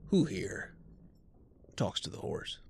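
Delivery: low, quiet boss voice; boss character. Acted. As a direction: ask it low and softly, as the boss. Game master voices the boss Blondie.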